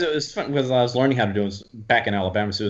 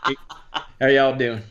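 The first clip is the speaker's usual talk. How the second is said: Southern greeting in Alabama twang; Alabama accent; 3 semitones higher than usual. Shown as a demonstration. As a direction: call out a greeting like an Alabama local. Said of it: "right back in Alabama".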